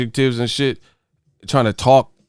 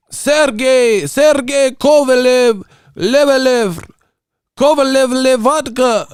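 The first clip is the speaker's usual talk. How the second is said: high mock-Slavic gibberish voice; pitched-up, punched-out nonsense names; speaking in tongues; 10 semitones higher, 4 dB louder, slower than usual. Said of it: "these dudes be speaking in tongues".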